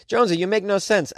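mock listener. Voices a listener scolding him.

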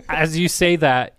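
ordinary delivery

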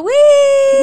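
high, loud cheer of joy; excited whoop. Real, not acted. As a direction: let out a bright whoop of delight. Cheering the new episode.

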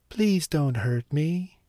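high, slow, pleading plea; begging. Acted. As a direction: beg for your life softly. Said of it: "she begged".

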